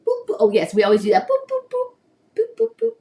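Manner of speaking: high playful boops; squeaky boop game. Real, not acted. Booping game with baby.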